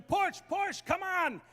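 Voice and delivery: high shouted push orders; doctor screaming. Retold or imitated. Doctor urging the mother in the delivery room, shouting. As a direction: bark it like a frantic doctor. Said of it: "he's screaming".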